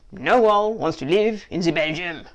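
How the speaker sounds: dubious attempt at a Belgian accent. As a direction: put on a shaky-guess Belgian accent. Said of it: "not sure that's a Belgian accent".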